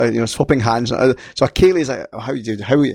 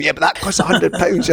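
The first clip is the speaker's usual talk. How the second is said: laughing quote; 6 semitones higher than usual. Retold or imitated. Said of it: "My mother said".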